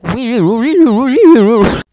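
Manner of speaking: high, loud mock siren wail; police siren imitation. Mocking French cop cars with a loud siren wail. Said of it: "French police cars be like".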